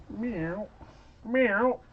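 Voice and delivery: very high squeaky meow; cat meow. Pretend. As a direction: squeak it like a tiny kitten. Cat emoji meows.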